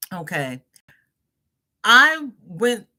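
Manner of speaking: normal talking voice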